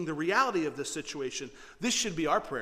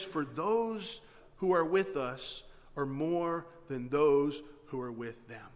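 slow, deliberate, weighty phrasing; calm assurance; slower than usual. Acted. Prophet assuring servant about the army, calm and deliberate. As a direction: spell out the promise unhurriedly. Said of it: "Elisha calmly responds".

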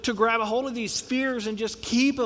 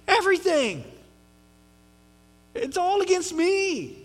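put-on whiny kid; 11 semitones higher, 5 dB louder than usual. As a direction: wail it like a sulking kid. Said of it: "You ever heard that from a kid?".

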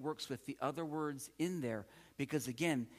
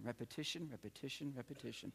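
each repetition softer, trailing away; fading repetition; 9 dB quieter, faster than usual. Shown as a demonstration. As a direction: chant it and let it fade. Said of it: "repetition is the key".

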